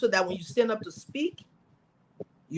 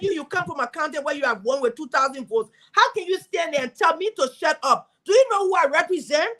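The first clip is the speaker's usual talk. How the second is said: higher, pressing defiant retort; retold confrontation; 6 semitones higher than usual. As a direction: put him in his place, building indignation. Confronting senator on the floor.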